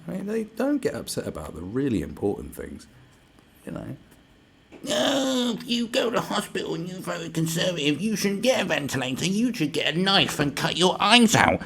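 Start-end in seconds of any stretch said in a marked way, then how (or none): 4.9-11.6 much higher, whiny mocking voice; mocking a virtue signaller. Mocking virtue signallers in a high whiny voice. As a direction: sneer it like a shrill online zealot. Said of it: "That's what they're talking about".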